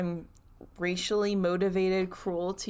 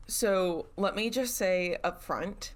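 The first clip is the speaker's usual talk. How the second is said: normal talking voice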